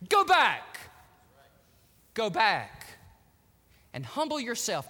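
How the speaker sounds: loud, stern "Go back", then eased; God ordering her home, firm and loud; divine command. Acted. God telling Hagar to go back, stern command.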